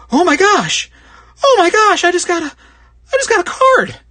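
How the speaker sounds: high, loud squeals of disbelief; excited card opener. Retold or imitated. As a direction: squeal like you just pulled a jackpot card. Card opener's shaking hands.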